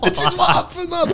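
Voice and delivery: high laugh through the words. Real, not acted. Storyteller laughing at his own tale, high laugh. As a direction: crack up mid-sentence.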